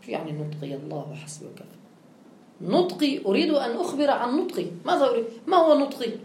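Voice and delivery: usual speaking voice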